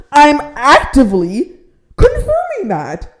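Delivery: snapping back at the rental agent, exasperated. Real, not acted. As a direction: spell it out, fed up.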